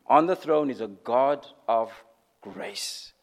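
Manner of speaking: dropping to a near whisper on grace; hushed reverent drop. Real, not acted. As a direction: let grace fall almost silent. Preacher drops to whisper on grace.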